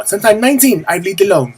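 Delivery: horrible [name]. Acted. Vlogger mocking herself for living alone since nineteen, bad [name] impression. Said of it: "that's my horrible [name] impression".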